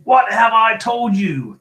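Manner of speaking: slow, high, furious shout; enraged full-voiced scolding; thundering. A narrator's reading. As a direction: thunder every word at him. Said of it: "thundered his uncle".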